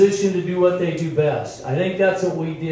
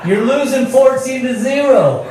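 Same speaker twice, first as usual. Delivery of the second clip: incredulous retort; 5 semitones higher than usual. Retold or imitated. Rival retelling his disbelief at a losing coach's optimism, incredulous. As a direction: build to an exasperated punchline.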